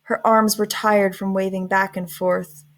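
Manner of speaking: usual speaking voice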